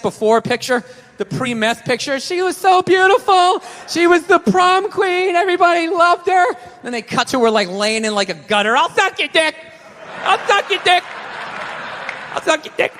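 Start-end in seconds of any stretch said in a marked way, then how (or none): 2.2-6.4 a much higher, slow, mock-tearful mother; tearful relative. Mother grieves her addict daughter on TV, mock tearful. 8.8-12.9 strung-out addict. Retold or imitated. Addict in gutter voiced.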